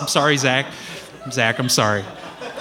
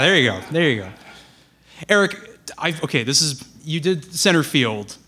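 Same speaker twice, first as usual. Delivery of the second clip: ordinary delivery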